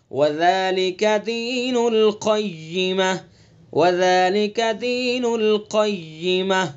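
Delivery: "ذالک" slow with a soft, gentle dh; slow tajweed model of "ذالک"; soft dhal. Shown as a demonstration. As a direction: recite slowly with gentle dhal. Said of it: "زال کو نرمی سے پڑھیں گے".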